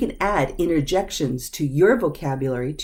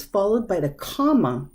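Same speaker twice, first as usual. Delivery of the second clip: ordinary delivery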